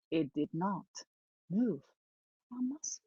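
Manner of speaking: very soft, halting words; suspenseful hush. A narrator's reading. Storyteller watches the cat not move a muscle, hushed. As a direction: drop almost to nothing, pausing between words.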